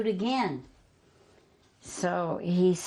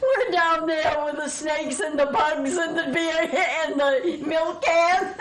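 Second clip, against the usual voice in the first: high, laugh-filled telling; words squeezed out between laughs; 9 semitones higher, 4 dB louder than usual. Real, not acted. Laughing at childhood memory.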